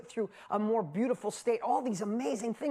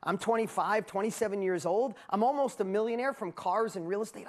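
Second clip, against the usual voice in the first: ordinary talk, nothing marked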